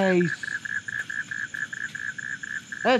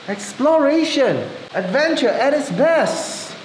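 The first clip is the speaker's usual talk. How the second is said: mock announcer; 6 semitones higher, slower than usual. Playful announcing in the cave.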